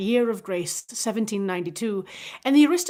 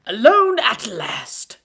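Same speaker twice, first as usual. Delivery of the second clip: lightly voiced, slow jovial lord; a relieved, hearty sigh of words; slow, louder jovial nobleman; 2 semitones higher, 8 dB louder, much slower than usual. A narrator's reading. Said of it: "said Lord Antony jovially".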